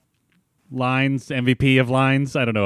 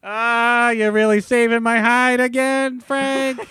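high pleading showman; high goofy voice; 11 semitones higher than usual. Acted. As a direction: pitch way up, eager and pleading. Plays Hank pleading with Frank.

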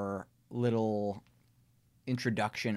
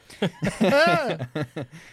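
loud laugh; 9 semitones higher, 8 dB louder than usual. Real, not acted. Host laughs at own joke.

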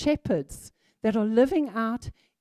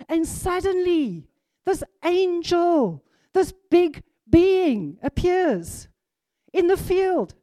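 higher, louder, slow build of wonder; excited; 6 semitones higher, 5 dB louder than usual. Real, not acted. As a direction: paint the angel appearing, thrilled. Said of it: "Man, I'm getting excited".

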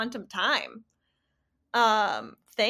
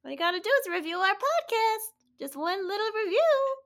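put-on high little kid pleading; small child voice; 9 semitones higher than usual. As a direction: ask sweetly like a small child. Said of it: "Thank you, small child".

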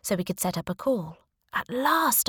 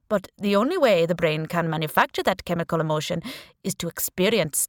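put-on Indian accent; 3 dB louder than usual. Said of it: "he said in a strong Indian accent".

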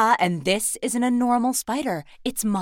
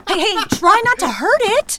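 loud high urgent plea; urgent shout; 10 semitones higher, 8 dB louder than usual. Acted. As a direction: yell at him to be gentle. Pulling spider off.

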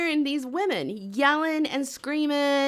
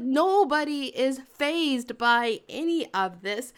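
ordinary talk, nothing marked

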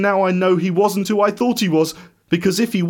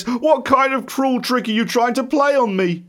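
tearful accusation; 6 semitones higher than usual. A narrator's reading. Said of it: "with tears streaming down my face".

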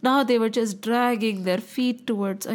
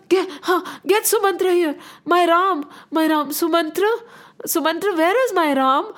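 frantic father calling, pitched higher; urgent, desperate demand; 6 semitones higher, 3 dB louder than usual. Acted. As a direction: demand your son in a raised, urgent voice. King urgently demands his son.

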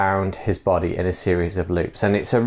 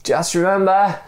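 raised-pitch mock shout; a raised call from the dangling man; storytelling shout; 9 semitones higher than usual. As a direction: call it up from below. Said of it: "shouted the other man".

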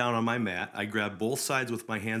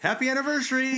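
cheerful parting call; 5 dB louder than usual. Retold or imitated. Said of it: "they said".

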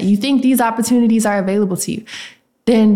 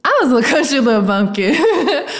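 laughing at herself in disbelief; amused laughing; 5 semitones higher than usual. Real, not acted. Host to listeners about the childhood recording, amused and laughing. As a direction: talk through an amused laugh.

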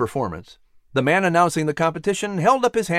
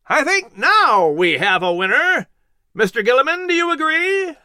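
high, louder call over the crowd; announcer's shout; 12 semitones higher, 3 dB louder than usual. A narrator's reading. Announcer asking Gilliman to confirm the winner, high and louder. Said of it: "held up his hands and shouted".

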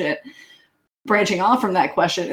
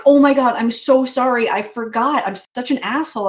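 higher-pitched imitation of an apologetic friend; gushing mock apology; 5 semitones higher, 3 dB louder than usual. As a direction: blurt a loud, high "oh my God", then ease off. Imitates apologetic friends who forgot.

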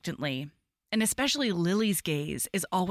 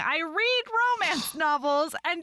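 incredulous; 11 semitones higher than usual. Real, not acted. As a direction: rant at your own slowness. Mock outrage at herself.

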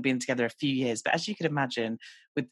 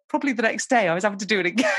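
laughing; 7 semitones higher, 4 dB louder, faster than usual. Real, not acted. Laughing at herself.

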